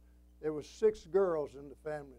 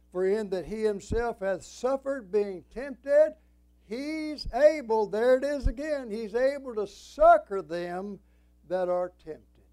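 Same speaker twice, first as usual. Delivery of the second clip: loud, slow, raised-pitch proclamation; forceful scripture preaching; 5 semitones higher, 10 dB louder than usual. Real, not acted. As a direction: declaim the verse, leaning on each phrase. Preaches a scripture verse at full force.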